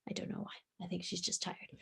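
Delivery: soft, whispered remark about the pet; whispered aside. Real, not acted. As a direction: murmur it so the pet can rest.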